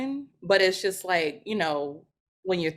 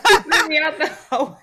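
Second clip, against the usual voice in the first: laughing; 9 semitones higher, 8 dB louder than usual. Real, not acted. Laughing mishearing.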